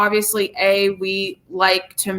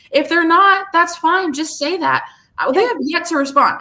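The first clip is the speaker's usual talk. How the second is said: higher pitched, exasperated complaint; 8 semitones higher than usual. Real, not acted. Complaining to viewers that the crew ignores her emails, exasperated. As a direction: vent the frustration. Airing grievance about unanswered emails.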